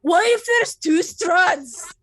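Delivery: loud, high alarmed question; alarmed shout. Real, not acted. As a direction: blurt it out in alarm.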